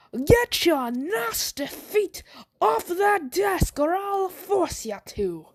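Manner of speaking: high, slow Irish threat drifting toward Texan; hammed-up Irish accent, stretched and high-pitched; dubious Irish accent. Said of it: "Was that still an Irish voice".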